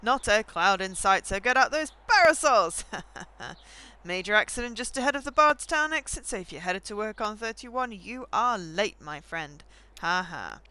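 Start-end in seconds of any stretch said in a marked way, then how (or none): 0.0-9.6 upbeat morning radio read; radio announcer. Acted. DJ giving listeners weather and traffic, chipper. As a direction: sell the forecast like a DJ.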